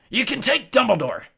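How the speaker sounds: high, loud, outraged yell. A narrator's reading. Hagrid defends Dumbledore against Malfoy, yelling. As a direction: yell at full pitch. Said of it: "yelled Hagrid".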